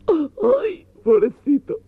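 very high, wailing camp sob; dramatic crying widow. Acted. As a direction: overact the grief of a heartbroken lover. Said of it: "con la vena dramática llorando".

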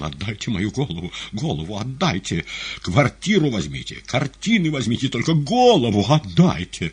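muttered plea building to loud, high cries; tearful pleading. A narrator's reading. Weeping compère begging for his head, rising tearful plea. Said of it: "Конферансье плакал".

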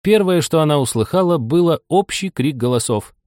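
plain reading voice

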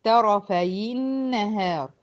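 very slow "طرفي النهار" as tarafayi-nnahar; drawn-out tajweed example, "طرفي النهار" linked with i; demonstrating the kasra link. Shown as a demonstration. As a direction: hold the doubled n in "طرفي النهار" after a short i. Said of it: "حركتها بالكسر".

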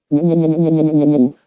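long ringing howl; ringing jackal howl. Acted. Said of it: "звонко разразился шакал".

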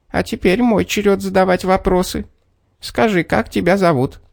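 high, demanding little man; bossy little man. A narrator's reading. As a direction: demand an answer in a tiny voice. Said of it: "человечек заявил".

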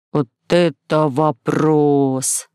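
very slow, low exhalation; drawn-out low gasp of amazement; breathed out. A narrator's reading. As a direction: exhale each word slowly, overwhelmed. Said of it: "выдохнул Гумгам".

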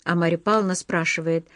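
usual speaking voice

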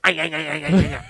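a lightly put-on French accent, just syllables; imitating a French Canadian leader in a French accent; a hint of a French accent babbling; mock French accent. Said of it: "talking in this deep French accent".

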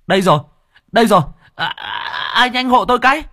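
high, loud breathless call for help; panting diver. Acted. Diver surfacing with the body, calling breathlessly, high and loud. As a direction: gasp it out after surfacing. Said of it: "trồi lên thở hồng hộc".

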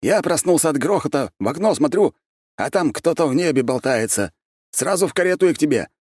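high-pitched, breathless-with-news character voice; flustered friend who has just rushed over; agitated friend. A narrator's reading. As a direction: tell it excitedly, pitched well up.

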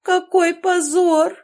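hammed-up despair building to a wail; dying wail. A narrator's reading. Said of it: "умирающим голосом простонав".

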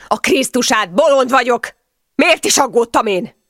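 loud, high angry cursing; furious yelling. A narrator's reading. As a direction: roar with exasperated anger. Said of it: "ordított ismét". (dühödt ordítás)

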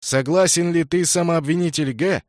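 ringing high herald's call; town crier. A narrator's reading. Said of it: "звонким голосом глашатая спросил".

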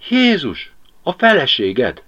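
Ágnes warning Pali, startled and alarmed; startled cry. Acted. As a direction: blurt it out in shock. Ágnes startled seeing wife.

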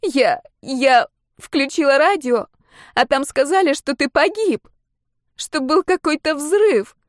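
high, distraught wail; slow, high-pitched sobbing account; through tears. A narrator's reading. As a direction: stammer it out tearfully in a high voice. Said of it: "проговорила сквозь очередную порцию слёз".

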